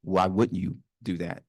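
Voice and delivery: ordinary delivery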